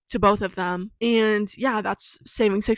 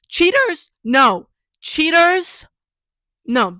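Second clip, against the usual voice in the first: high, loud sing-out of "cheaters"; emphatic disapproval; 6 semitones higher, 6 dB louder, much slower than usual. Real, not acted. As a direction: call it out high, then a firm loud "no". Ranting against cheaters.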